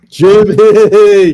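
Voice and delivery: loud, high shout of the name; calling out. Real, not acted. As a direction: yell Jimmy across the room, falling at the end. Calling out to Jimmy on air.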